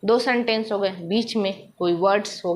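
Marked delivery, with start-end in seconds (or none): none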